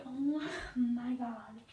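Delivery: breathless frightened whisper, slow; scared whisper. Retold or imitated. Whispering to Mike as the doorbell rings, scared. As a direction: hush it out in fear.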